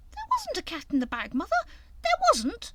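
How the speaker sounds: put-on high, loud protesting child; high indignant protest. A narrator's reading. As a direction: insist indignantly like a small boy. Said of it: "Fuzzy Peg protesting".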